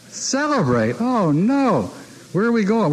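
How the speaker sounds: loud, high mock protest; exhausted, balking at partying; incredulous protest. Exhausted man protests late celebration.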